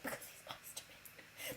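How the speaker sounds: barely voiced, very soft whisper; mock meek reply. Mum explaining why she listens to him, whispered. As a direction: whisper it like a small sulk. Imitating mum's reply, under breath.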